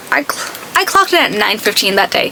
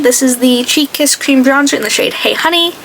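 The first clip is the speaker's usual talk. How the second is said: ordinary delivery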